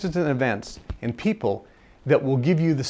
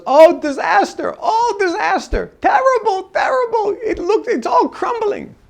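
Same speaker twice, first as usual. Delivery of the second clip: very high, slow laughing mock lament; giggling high-pitched moan of doom; laughing about disaster; 5 dB louder than usual. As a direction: cheerfully wail that it's a disaster. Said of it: "and he's laughing".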